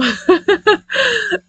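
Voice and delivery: sudden high giggle; real burst of laughter. Real, not acted. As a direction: crack up at yourself. Laughs at own renaming.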